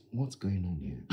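ordinary talk

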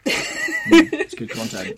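high squeaky laugh through words. Real, not acted. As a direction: giggle the words out. Laughing at Ailish's joke.

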